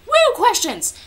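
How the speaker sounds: high, loud whoop; excited cheer. Real, not acted. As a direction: cheer it like a party. Mock cheer about questions.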